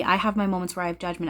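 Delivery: usual speaking voice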